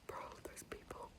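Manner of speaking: embarrassed whisper after being overheard; sheepish whisper. Real, not acted. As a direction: hush it so the people nearby can't hear. Embarrassed after shouting in public.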